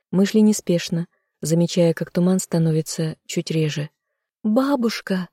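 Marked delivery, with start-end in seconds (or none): 4.4-5.3 higher-pitched searching call. A narrator's reading. Heroine calling her lost grandmother in fog, calling out. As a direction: call for someone out of sight. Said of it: "позвала я опять".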